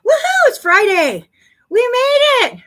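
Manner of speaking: high, loud whoop; happy cheer to viewers. Real, not acted. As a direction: celebrate the Friday out loud. Cheering the end of week.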